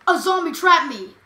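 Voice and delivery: stuttered, loud outcry; loud complaint mid-game; loud alarm. Real, not acted. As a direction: yell for your friend's attention. Trapped by zombie in game.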